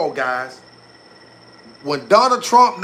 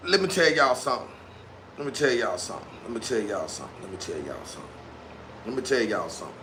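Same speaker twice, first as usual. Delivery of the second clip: each repeat lower and quieter; fading echo; 8 semitones lower, 11 dB quieter, faster than usual. Pretend. Same phrase repeated, dying away like echo.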